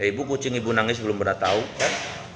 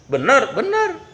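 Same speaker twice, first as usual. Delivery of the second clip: high insistent voice; 12 semitones higher, slower than usual. Acted. Voice insists deer returns.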